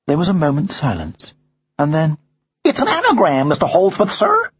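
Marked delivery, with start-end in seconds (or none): 2.6-4.5 high, deferential parrot voice; respectful parrot. Acted. Parrot solving the father's crossword clue, high and respectful. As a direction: offer it politely as an American parrot. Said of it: "said Madison in a respectful voice".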